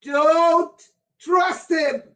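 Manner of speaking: a raw high-pitched warning yell. Real, not acted. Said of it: "I'm sorry for yelling".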